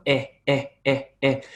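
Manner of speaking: EH in short, separate bursts; unblocked EH from the back of the throat; vowel from the throat. Shown as a demonstration. Teacher showing unblocked vowels to students, throaty vowel demo. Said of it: "coming purely from the back of my throat".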